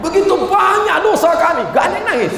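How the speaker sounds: high-pitched mock lament; staged weeping plea. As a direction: plead loudly as if trying to make a crowd cry. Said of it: "saya tes nangis". (ratapan doa yang dibuat-buat, bernada tinggi)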